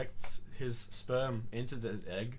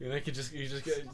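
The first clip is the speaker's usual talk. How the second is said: normal talking voice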